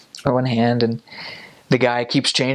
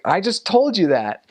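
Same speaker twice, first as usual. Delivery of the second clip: higher-pitched, put-out reply; exasperated; 8 semitones higher than usual. Retold or imitated. Missionary to wife who asks again, exasperated. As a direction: protest at being asked twice.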